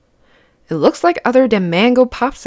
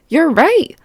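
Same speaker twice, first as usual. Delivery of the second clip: cheerful; 7 semitones higher, 3 dB louder than usual. Real, not acted. Praising a child's guess.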